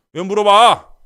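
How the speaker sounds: exasperated yell. Pretend. Boyfriend yelling.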